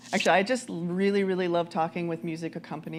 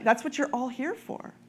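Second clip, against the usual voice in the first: ordinary talk, nothing marked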